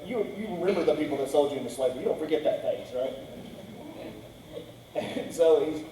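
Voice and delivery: normal talking voice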